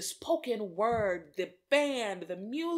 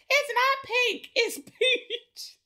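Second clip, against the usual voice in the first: high, loud playful correction; mock childlike protest; 13 semitones higher, 6 dB louder, slower than usual. Children correcting neighbors about their house, in indignant mock protest.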